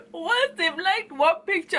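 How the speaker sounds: laughing through the words; can't stop laughing as you say it; giggling while telling the story. Real, not acted.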